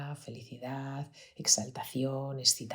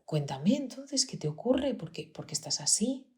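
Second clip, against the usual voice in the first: tender coaxing; 8 semitones higher than usual. Retold or imitated. Mother gently coaxes her upset son to explain.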